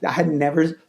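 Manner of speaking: normal talking voice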